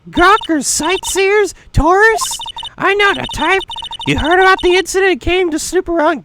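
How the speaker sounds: put-on high, loud cranky old woman; old lady voice. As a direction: squawk it like a nosy old guard. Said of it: "God, my voice hurts from doing an old lady voice".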